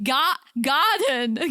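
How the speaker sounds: slow British "garden", laughing; British accent. Retold or imitated. Retelling the pizza order, imitating herself in a British accent. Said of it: "started doing British accent".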